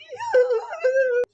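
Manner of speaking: long, loud, high fake wail; mock bawling. Commentator making fun of a streamer's breakdown, bawling fake cry. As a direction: bawl like an exaggerated crybaby. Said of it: "making fun of his mental breakdown".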